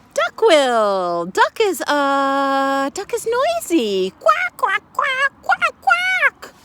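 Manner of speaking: very high loud duck voice. Acted. Teacher playing the noisy duck, very high and loud.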